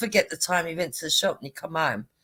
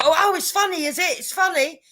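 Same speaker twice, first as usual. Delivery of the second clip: sarcastic; 9 semitones higher, 3 dB louder than usual. Retold or imitated. Offended husband sarcastic.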